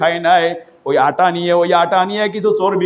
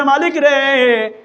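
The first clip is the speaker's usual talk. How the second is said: high, slow chanted call; 7 semitones higher, much slower than usual. Retold or imitated. Omar calling to his Lord, chanted call. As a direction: call on God high and drawn out. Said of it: "ডাক দিয়ে বলতেছেন".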